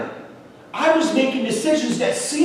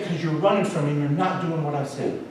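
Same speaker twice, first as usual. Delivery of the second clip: lower, softer voice of God answering; God's quiet reply; 7 semitones lower, 6 dB quieter, faster than usual. Retold or imitated. God answering the frustrated man in prayer, quiet and firm.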